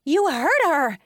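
high, loud accusation; accusing cry. A narrator's reading. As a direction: cry out in outrage. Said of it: "Elizabeth cried".

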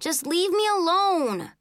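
high, drawn-out yell. A narrator's reading. Child begging brother to leave, high slow yell. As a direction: yell it slowly, pitched high. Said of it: "I yelled".

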